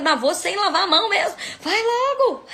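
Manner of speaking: very high, fast, through laughter; flustered, laughing rush. Retold or imitated. Speaker hurrying out with unwashed hands, flustered laughing rush. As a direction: laugh your way through a comic panic.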